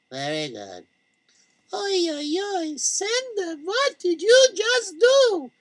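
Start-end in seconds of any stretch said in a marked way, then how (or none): 1.7-5.5 high calling shopkeeper voice; Malky calling out. Acted. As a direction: call out, high and rising. Malky calls out to Sender.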